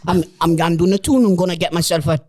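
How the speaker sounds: broad Geordie, punched out in bursts; Geordie accent. Acted. Asked by mates for an attempt, hammed-up Geordie accent. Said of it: "Geordie accent?".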